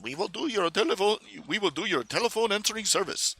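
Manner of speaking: mock Indian accent. Mocking Indian call centre stereotype.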